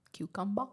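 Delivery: "Cucumber" as "kyoo-KUM-buh", no final r; "Cucumber" with an open "-buh" ending; regional pronunciation. Retold or imitated. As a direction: drop the r in "Cucumber", rising. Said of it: "I've heard that".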